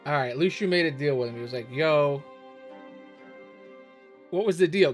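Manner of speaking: ordinary talk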